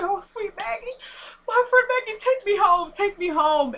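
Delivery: high-pitched hammed-up lament; mock grieving. Veronica storming out over her friend Maggie, mock grieving. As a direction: imitate a distraught woman, voice way up high.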